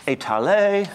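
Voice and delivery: étaler said the French way, ay-tah-LAY, up high with the final syllable stressed; French pronunciation. Shown as a demonstration. Lecturer models the French word for his audience, careful French pronunciation. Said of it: "There are two French words".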